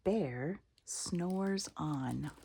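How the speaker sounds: usual speaking voice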